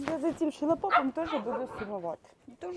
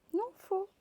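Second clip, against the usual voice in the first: crying; 7 semitones higher, 7 dB quieter than usual. Real, not acted. Woman crying saying goodbye to puppies.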